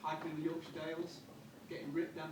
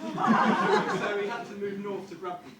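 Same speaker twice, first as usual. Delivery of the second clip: laughing; 4 semitones higher, 13 dB louder, slower than usual. Real, not acted. Punchline, laughing.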